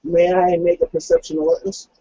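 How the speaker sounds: usual speaking voice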